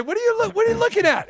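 high, fast mock British shrill; Monty Python voice. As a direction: squeak it out like a Python sketch character. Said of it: "What's the old Monty Python skit?".

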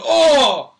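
loud, high mock cry of pain; hammed-up yelp at a cramp. Runner struck by cookie cramp, crying out in mock agony. Cramp hits mid-race, acted.

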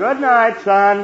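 loud, high goodnight call. Acted. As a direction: project it to another room. Father calls goodnight to son.

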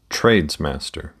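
usual speaking voice

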